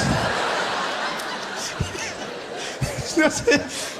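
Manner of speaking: high breathless shrieking laugh; real laugh. Real, not acted. Comedian cracking up at his own crowd, wheezing.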